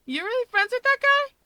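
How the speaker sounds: high, loud disbelieving check; a loud high double take at Rika. Acted. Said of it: "He double checks".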